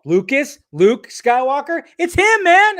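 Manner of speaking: questions climbing to a high-pitched exclamation; retold excited revelation. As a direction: act out a mind-blown realization, pitch leaping. Acts out [name]'s mind-blowing revelation.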